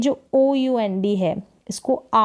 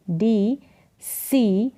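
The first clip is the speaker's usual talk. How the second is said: normal talking voice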